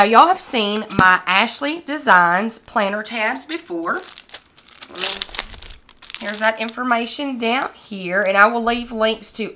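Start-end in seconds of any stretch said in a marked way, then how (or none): none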